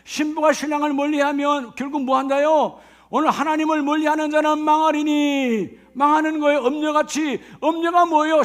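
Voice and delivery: normal talking voice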